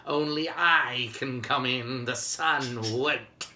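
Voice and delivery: slow, drawn-out mischievous frost spirit; slowly gloating Jack Frost; Jack Frost's voice. Acted. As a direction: stretch every word like a sly winter spirit. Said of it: "old Jack Frost himself said".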